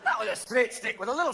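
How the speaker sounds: a Scot waving off the idea; mock Scottish scoff; Scottish accent. Imagined Scot replying.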